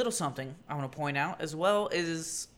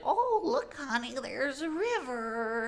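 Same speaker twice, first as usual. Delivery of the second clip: put-on high, slow old-timer wonder; old person delight; 10 semitones higher, slower than usual. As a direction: marvel like a retiree. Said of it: "they're like".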